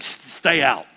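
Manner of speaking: low, curt stay out; a hint of a hurt wife's dismissal; wife refuses to open the door; wife shutting him out. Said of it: "She's like".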